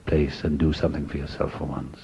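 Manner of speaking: soft, hushed inner voice; inner little whisper. Retold or imitated. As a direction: murmur it like a voice inside your head. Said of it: "went off like a little whisper".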